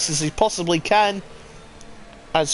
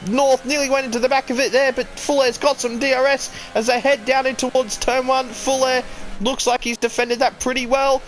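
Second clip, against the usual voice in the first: excited live race call, pitch raised; motorsport commentator; 4 semitones higher than usual. Real, not acted.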